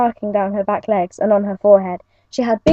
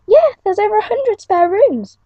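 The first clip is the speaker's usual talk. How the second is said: high eager declaration; booming; 11 semitones higher than usual. Acted. Jay tells Chiyuki about the spare rooms, high eager booming. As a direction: show off the big palace. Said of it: "boomed Jay".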